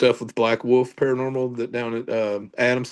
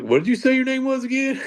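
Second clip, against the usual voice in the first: mock puzzled self; 11 semitones higher than usual. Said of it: "I'm like".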